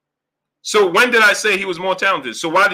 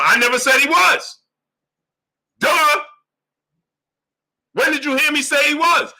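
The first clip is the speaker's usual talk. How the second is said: heated shout at doubters; 8 semitones higher than usual. Real, not acted. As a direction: yell it at the chat in disbelief. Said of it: "why am I screaming?".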